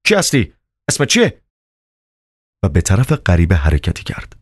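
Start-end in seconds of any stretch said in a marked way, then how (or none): 0.1-1.4 firm, higher-pitched questioning. A narrator's reading. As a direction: demand an answer with authority. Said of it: "با قاطعیت گفت".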